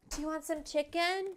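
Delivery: high, cooing pet voice; squeaky pet-owner coo. Real, not acted. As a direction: sweeten it way up for the dog. Offering dog chicken.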